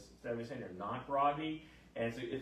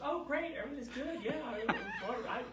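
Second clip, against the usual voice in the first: high, bubbly mimicked delight; gushing happy patient; 13 semitones higher than usual. Patient praises doctor afterwards, high gushing cheer. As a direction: gush sweetly with a laugh in it.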